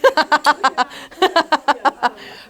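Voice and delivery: real laugh breaking out high; bright burst of laughter. Real, not acted. Laughing at the husband's endless breakfast questions, real laugh.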